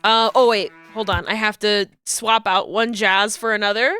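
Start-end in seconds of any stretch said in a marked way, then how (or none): none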